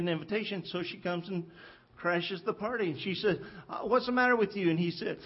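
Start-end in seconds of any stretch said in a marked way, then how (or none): 3.7-4.7 higher, quick questioning wife voice; a wife barging in, impatient; nagging wife. Retold or imitated. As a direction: Jezebel checking on her sulking husband. Jezebel asks sulking Ahab.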